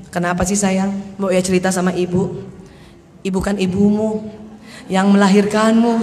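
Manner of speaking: low, tender coaxing; coaxing mother. Acted. Mother pressing her angry son to confide, cloyingly tender. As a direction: coax softly, voice pitched well down. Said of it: "Saya dulu salah".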